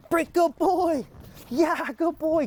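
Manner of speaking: high, excited praise for the dog. Shown as a demonstration. Trainer hypes up the dog with praise, high and excited. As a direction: gush over him to pump him up. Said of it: "Get him excited, be enthusiastic".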